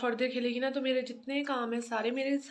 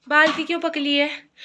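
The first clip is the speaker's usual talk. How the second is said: loud, raised mother voice; 5 semitones higher, 14 dB louder than usual. Real, not acted. Mother asking child about the bucket, loud and raised.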